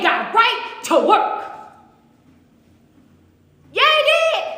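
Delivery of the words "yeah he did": high exclaimed agreement. Real, not acted. Preacher affirming his own point to the church, high-pitched. Congregant-style shout of agreement.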